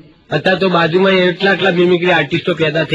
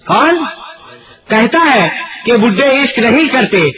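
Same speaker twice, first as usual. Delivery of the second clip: declaiming couplet; 5 semitones higher than usual. Pretend. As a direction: proclaim the couplet like a stage poet. Recites boy's couplet, repeated louder. (ઊંચા અવાજે શાયરી પઠન)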